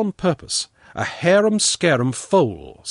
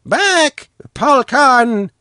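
high, loud command to a dog; watchman's shout; 14 semitones higher, 9 dB louder, much slower than usual. A narrator's reading. Watchman calling off his terrible dog, shouting. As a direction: shout sharply to call off the dog. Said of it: "the watchman shouted".

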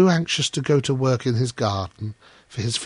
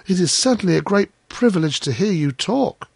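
lightly voiced humble Hans, pitched higher; a hint of meek, earnest admiration; admiring little Hans; 6 semitones higher than usual. A narrator's reading. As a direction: praise a friend with innocent awe.

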